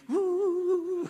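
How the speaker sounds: very high, loud sustained "wuuh" wail; exaggerated child's bawling. Child crying harder once mother sympathises, mock wail. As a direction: crank up a fake cry. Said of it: "anaknya nangisnya tambah dikencang-kencangin".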